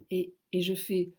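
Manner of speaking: ordinary talk, nothing marked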